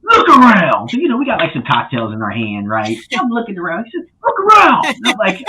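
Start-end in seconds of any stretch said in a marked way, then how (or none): 0.0-0.9 put-on loud, very high old-man holler; hammed-up high-pitched shout; mentor impression. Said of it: "he talks like this". 4.2-4.9 put-on loud, very high old-man holler; hammed-up high-pitched shout; mentor impression. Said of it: "he talks like this".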